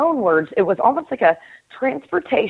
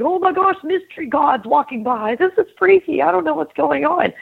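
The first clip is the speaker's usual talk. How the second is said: much higher, panicky little voice; freaked-out snow globe figure; 9 semitones higher than usual. Acted. Figure in the snow globe panicking at shapes outside, much higher. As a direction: gasp at the mystery gods walking by.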